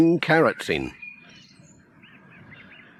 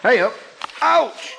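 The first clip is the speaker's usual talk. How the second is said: startled ouch; 12 semitones higher than usual. Acted. Pat bumping in dark.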